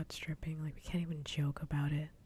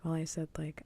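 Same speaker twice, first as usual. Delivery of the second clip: whispering. Real, not acted. Whispers to viewers throughout.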